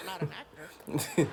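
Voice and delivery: ordinary talk, nothing marked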